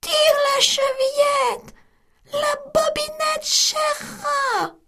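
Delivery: granny calling from bed to the door; weak voice. Acted. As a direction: call weakly from the sickbed. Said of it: "lui cria d'une voix faible".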